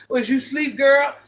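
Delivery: teasing imitation of a pushy asker; imitated nosy question. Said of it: "Everyone always asks me".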